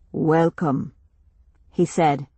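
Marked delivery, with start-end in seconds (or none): 0.1-0.9 slow, solemn stranger. Acted. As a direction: speak as a grave village chief.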